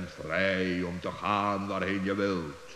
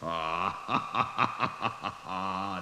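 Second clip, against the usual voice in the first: a long amused laugh, somewhat altered voice; mocking laugh. The wizard laughing at trusting Paulus, long amused laugh. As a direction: laugh indulgently at gullible Paulus.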